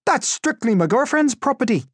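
very high, loud protest; loud, high-pitched indignation; indignant captor. A narrator's reading. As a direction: shout it with mock outrage. Same captor, after slapping prisoner.

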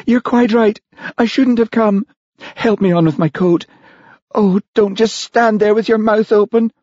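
very high, offended lady's voice; indignant. Acted. Olivia leaving Charlie's dinner, indignant. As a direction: order the host about in a huff.

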